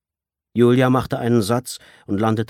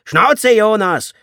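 loud, much higher, slow furious yell; shout; 11 dB louder, much slower than usual. Acted. Leader screams at the captive to shut up. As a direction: bark him into silence. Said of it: "schrie der Anführer".

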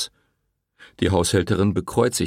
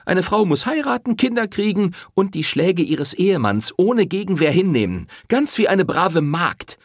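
high, sarcastic singsong of the refrain; mocking parody; 11 semitones higher, 4 dB louder than usual. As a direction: ape his sermon with contempt. Rosetta mocks the priest's refrain. (spöttisch nachgeäfft)

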